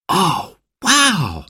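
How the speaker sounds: put-on high child's wow of amazement; awed gasp. A narrator's reading. Simon seeing the tall mountain, gasped in awe. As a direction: marvel in a high voice. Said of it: "gasped Simon".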